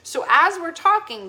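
ordinary talk, nothing marked

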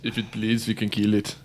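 low-pitched mock Austrian accent; Arnold. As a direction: do an almost-there Austrian action-hero accent. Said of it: "I can almost do Arnold".

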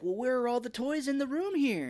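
indignant Fred. Retold or imitated. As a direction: demand it like a petulant imp. Imitating Fred asking.

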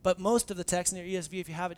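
normal talking voice

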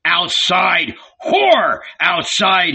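furious roar. A narrator's reading. Barabbas hauls Magdalene into the yard, roaring in fury. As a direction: drag her out, roaring. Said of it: "he roared".

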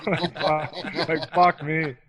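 laughing through the words; giggling disbelief at himself. Real, not acted. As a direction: crack up as you say it. Laughing at his young look.

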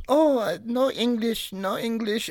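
high pitched imitation of grandma feigning no English; squeaky put-on broken English, playing dumb; mock Korean grandma. Grandson mocking his Korean grandmother, high put-on accent. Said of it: "she still does this".

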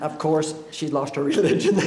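chuckling through the punchline; laughing. Real, not acted. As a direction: deliver it while laughing. Laughing at his own story.

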